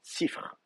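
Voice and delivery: Sifr soufflé, i bref; forme primitive chuchotée. Shown as a demonstration. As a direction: murmurer Sifr comme racine primitive. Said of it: "la voyelle primitive, c'est un I". (Sifr, chuchoté)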